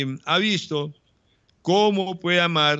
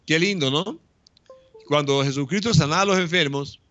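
usual speaking voice

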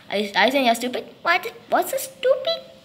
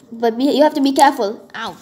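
ordinary talk, nothing marked

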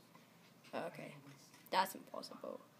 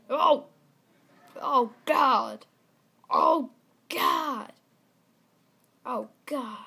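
loud, startled cries of dismay; alarmed outcry; 11 dB louder, much slower than usual. Real, not acted. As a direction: cry out as the game goes wrong. Reacting to crash in game.

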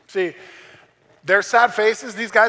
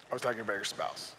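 dropped-voice joke aside; 8 semitones lower, 13 dB quieter, faster than usual. Real, not acted.

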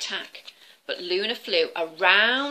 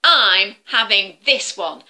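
slow, louder stubborn growl; angry dragon; 9 dB louder than usual. Acted. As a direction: plant your feet and refuse. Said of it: "roared the dragon".

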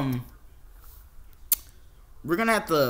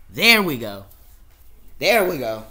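firm reset; 11 dB louder than usual. Real, not acted. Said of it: "resetting, louder".

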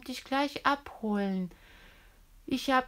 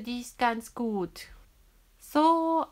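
ordinary talk

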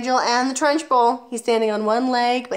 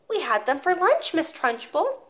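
put-on 1 small boy voice; 8 semitones higher than usual. Boy answers.

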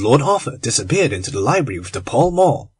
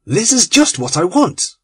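cried out; 6 semitones higher, 3 dB louder than usual. A narrator's reading. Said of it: "he cried".

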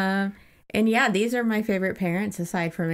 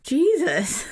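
mock exasperated exclamation; 8 semitones higher than usual. Real, not acted. Host reacting to all the chapter titles, mock exasperated.

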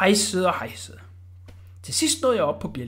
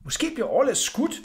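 usual speaking voice

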